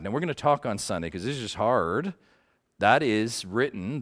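normal talking voice

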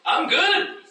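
very high, laughing self-satisfied reply; mock smug I'm good. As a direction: sound pleased with yourself. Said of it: "Smugness and all".

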